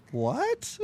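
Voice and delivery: confused rising "What?"; baffled what. Retold or imitated. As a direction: sound totally puzzled.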